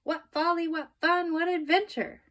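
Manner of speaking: high, loud delighted cries; gleeful exclamation. A narrator's reading. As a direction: revel in the thrill. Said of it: "said Baby Lincoln".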